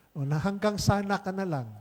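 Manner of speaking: ordinary talk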